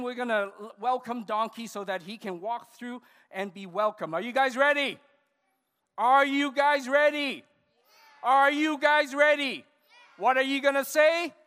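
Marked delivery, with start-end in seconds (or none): 4.1-11.3 high, loud hype-up of a crowd; rallying shout. Real, not acted. Rallying children for a group shout.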